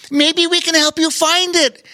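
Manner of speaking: put-on high childlike voice, exclaiming; eager little kid offering help, pitched high; excited Bobo Chico. A narrator's reading. Bobo Chico offering to help find the present, high and excited. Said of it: "exclaimed Bobo Chico".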